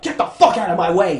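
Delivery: defiant shout. Retold or imitated. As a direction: yell straight back at the monster. Girl snaps back at the shadow.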